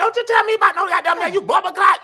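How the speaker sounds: high, loud mock Jamaican shouting; mock Jamaican rant. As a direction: yell it like a fake Jamaican tirade. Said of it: "She gonna turn Jamaican on your ass".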